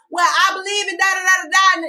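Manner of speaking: high, loud shouted quote; hollering. Retold or imitated. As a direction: yell it like she can't hear you. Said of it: "hollering / I'm like".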